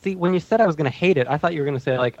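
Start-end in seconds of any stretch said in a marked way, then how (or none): none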